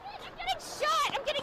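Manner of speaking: startled high cry of pain; alarmed cry. Real, not acted. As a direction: cry out as you're hit. Reporter hit by rubber bullet.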